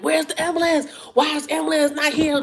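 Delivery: high-pitched indignant complaint; mad, impatient demand. Retold or imitated. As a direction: reenact getting mad, pitch jumping up. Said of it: "I'm getting mad".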